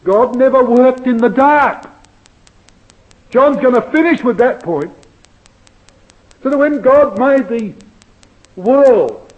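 loud, high pulpit fervour; preaching at full force. Acted. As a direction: drive it home to the congregation.

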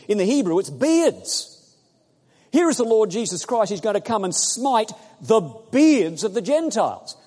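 loud, high, fervent preaching; climbing sermon fervour; preaching at full force. Real, not acted. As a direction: rouse the congregation, voice up and ringing.

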